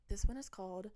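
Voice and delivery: ordinary delivery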